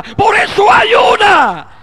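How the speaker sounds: high, slow, mocking yell of "ayuná"; mock excuse shout. Preacher mocking the believer's excuse, high slow shout. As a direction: stretch "por eso ayuná" into a wailing shout. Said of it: "Hermano, es que yo padezco".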